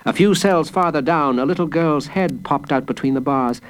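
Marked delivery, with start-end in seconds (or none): none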